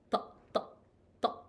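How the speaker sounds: "Ta" with a heavy, thick T; short, separate "ta" sounds on a thick T. Shown as a demonstration. Teacher demonstrates the letter to learners, thick T. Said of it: "we pronounce it as thick T".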